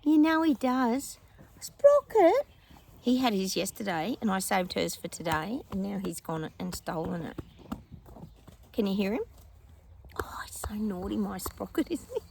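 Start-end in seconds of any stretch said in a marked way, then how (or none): 1.6-2.5 high, loud call to a dog. Real, not acted. Calling the dog. 10.2-12.2 whisper. Real, not acted. Fond remark about the dog.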